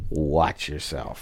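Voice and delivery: quiet, slow, low threat; low menacing warning. Pretend. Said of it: "And say".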